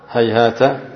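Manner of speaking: هيهات ending in a short "ta"; final ta with fatha. Shown as a demonstration. Teacher shows students the first ta vowelling, هيهات said "hayhaata". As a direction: say هيهات as "hay-HAA-ta", open final vowel. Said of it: "بتثليث التاء الفوقية".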